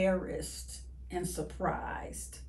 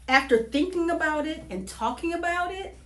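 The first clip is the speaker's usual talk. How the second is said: ordinary delivery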